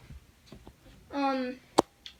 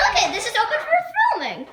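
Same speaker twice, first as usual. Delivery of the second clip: laughing; 8 semitones higher than usual. Real, not acted. Laughs while showing the room.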